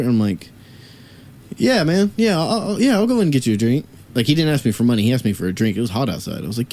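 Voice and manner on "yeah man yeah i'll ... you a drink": higher-pitched, eager-to-please retold reply; obliging. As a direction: act out agreeing to a favour. Retold agreeing to stranger's request.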